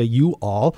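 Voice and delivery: "you all" as two separate words, uncontracted; full "you all". Shown as a demonstration. Host shows what lazy speakers avoid, "you all" uncontracted. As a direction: sound out "you all" without contracting it. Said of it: "we don't wanna say".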